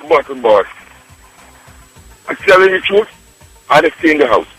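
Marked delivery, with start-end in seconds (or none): none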